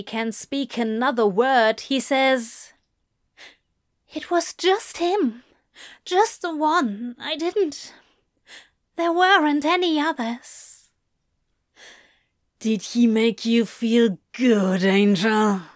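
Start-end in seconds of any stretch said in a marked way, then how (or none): 4.2-10.7 high, halting explanation; pleading Aziraphale. Acted. Aziraphale denying others to lover, pleading. 12.6-15.6 jealous, taunting growl. A narrator's reading. Crowley to lover about rival, growling. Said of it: "Crowley growls".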